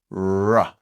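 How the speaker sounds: "R" as a loud phonics sound, not the letter name; isolated R sound. Shown as a demonstration. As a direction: voice "R" as a drawn-out rolling-in "rrruh". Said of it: "we've got the first sound there".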